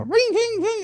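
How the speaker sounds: high ringing bell noise; mock ringing sound, pitched high; imitated ring. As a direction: chime it out like a bell.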